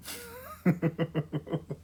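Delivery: high breathy laugh. Real, not acted. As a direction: burst out laughing. Laughs after her bit.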